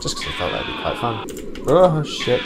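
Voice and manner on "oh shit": sudden high alarmed curse; startled shout. Real, not acted. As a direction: caught off guard, blurt it. Sudden danger in game.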